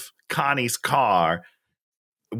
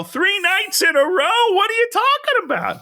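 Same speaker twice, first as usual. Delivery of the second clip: much higher, mock-incredulous squawk; incredulous outburst. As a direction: imitate a man exploding in disbelief. Retold outraged man repeats it, escalating.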